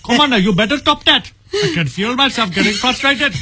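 high-pitched, hammed-up Indian-accented dad; mock Indian accent. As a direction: scold the kids in a high, put-on Indian accent. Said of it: "is an Indian accent".

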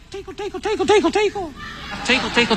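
high, light tinkling like raindrops; raindrop tinkle. Shown as a demonstration. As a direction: patter it high and soft like rain. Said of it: "You're the rain".

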